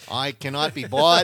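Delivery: lightly voiced crooked mayor swearing honesty; folksy pandering politician. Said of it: "I'm like".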